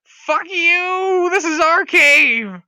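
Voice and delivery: high, drawn-out defiant screech; screaming kobold. Acted. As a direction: hurl it back as a furious kobold. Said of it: "they're all screaming".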